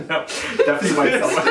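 laughing hard while talking. Real, not acted. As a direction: crack up mid-sentence. Bursts out laughing.

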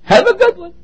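high, loud sing-song mockery; mocking a hollow farewell, high and loud; mocking cliche. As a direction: ham up the empty cliche. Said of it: "that means have a nice day".

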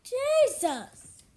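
exasperated. Real, not acted. Exasperated exclamation after yelling.

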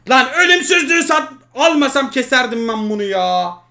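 loud frustrated outburst at himself; furious self-scolding; angry yell. Real, not acted. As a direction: vent the regret loudly. Frustrated gamer yelling at himself.

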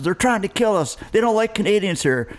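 high, alarmed certainty that they are under attack; a spooked father sure the locals want them dead; paranoid dad. Retold or imitated. Father warning the band in the van, high and alarmed. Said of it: "And my dad says".

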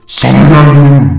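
loud, drawn-out menacing shout. A narrator's reading. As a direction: bellow it slowly like an apparition charging in. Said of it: "diye bağırdı".

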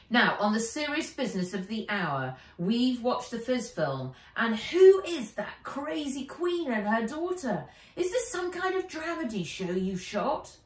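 high, loud, mock-serious girl; Wen, incredulous friend. Wen voiced in story.

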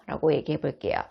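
normal talking voice

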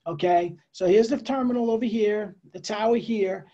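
ordinary talk

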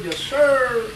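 ordinary talk

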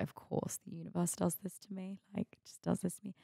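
barely voiced, as if talking to the universe; under-breath aside. Muttered aside about the universe.

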